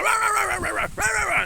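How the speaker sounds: high yappy mock barking "rah rah"; imitating dog bark. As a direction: yap it out like a tiny angry dachshund. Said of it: "He's like".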